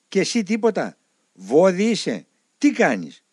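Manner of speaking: loud, high, exasperated scolding; the old elder scolds his sleepy novice, loud and high. Retold or imitated. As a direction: scold him for feeling nothing.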